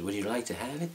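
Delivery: mimicking the chap's offer in a borrowed accent; RJ's accent. Speaker imitates RJ offering the mask, in a mock accent. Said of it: "that's not really your accent".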